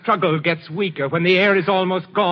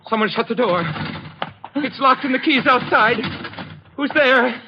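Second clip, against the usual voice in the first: panicked screaming; 13 semitones higher than usual. Acted. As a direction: scream like the air is running out. Killer trapped, suffocating in dark.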